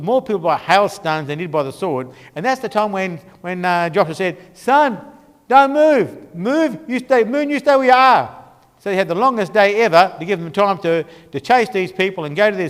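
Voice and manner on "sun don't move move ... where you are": loud, high-pitched order to the heavens; commanding shout. Acted. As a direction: call out a bold command, acting the leader. Joshua acted commanding sun and moon.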